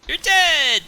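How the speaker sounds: mock triumphant call. Player announcing the dead or not roll, mock triumphant. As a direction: call it out high and teasing.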